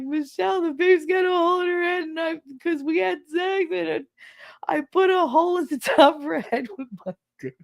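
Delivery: high, quick imitated wail; hammed-up panicked pleading, breaking into laughter; mock crying confession. Crying father explains to his mother, mock distraught wail. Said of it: "he was crying 'cause he thought he broke the baby".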